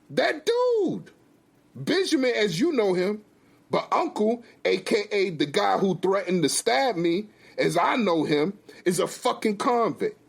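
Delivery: high, exasperated husband; heated, high-pitched explanation; angry accusation. Acted. Husband angrily names the convict.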